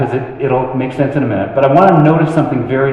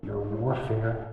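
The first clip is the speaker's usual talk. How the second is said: tender comfort; 2 semitones lower, 9 dB quieter than usual. Acted. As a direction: let each phrase settle softly, with pauses. Repeats comfort to the people.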